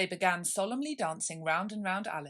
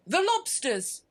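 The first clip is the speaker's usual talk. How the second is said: high, loud, bounding shout; excited shout; 11 semitones higher, 9 dB louder, slower than usual. Acted. Griffin leaping into the air with a high, loud shout. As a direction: shout it as you leap into the air. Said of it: "shouted the Griffin".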